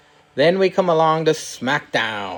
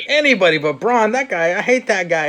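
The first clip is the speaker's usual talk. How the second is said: higher, whiny mock protest; hammed-up whiny refusal; whiny Sami Zayn; 6 semitones higher than usual. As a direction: complain like a petulant heel. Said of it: "And he's like".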